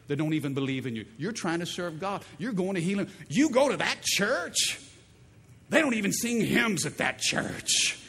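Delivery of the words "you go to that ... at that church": higher, scornful disbelief; sneering relative. Retold or imitated. Relative scoffing at the churchgoer, higher and scornful.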